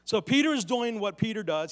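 usual speaking voice

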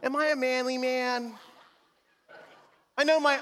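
ordinary talk

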